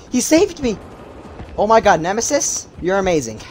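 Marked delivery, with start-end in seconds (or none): 0.1-0.8 excited relief. Real, not acted. Streamer repeating his rescue in disbelief, high excited cry. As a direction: say it again, still in disbelief.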